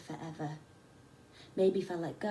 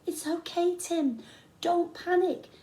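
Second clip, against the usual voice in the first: higher, louder calm reassurance; calling down reassuringly; 10 semitones higher, 5 dB louder than usual. A narrator's reading. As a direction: call down to steady him. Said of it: "Jake called down".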